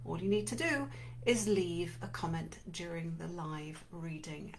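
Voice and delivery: normal talking voice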